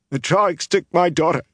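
high, anguished accusation; acted tearful wail; sobbing. A narrator's reading. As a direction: cry it out in grief. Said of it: "she sobbed".

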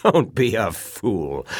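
slow, drawn-out mocking dismissal; laughing scorn. A narrator's reading. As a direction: stretch the words in derision. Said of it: "threw back his head and laughed".

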